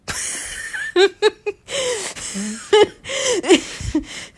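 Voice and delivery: high helpless cackling laugh; loud real laughter. Real, not acted. Reader cracking up at the fanfic, laughing hard. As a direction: lose it laughing at the line. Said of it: "I'm gonna cry".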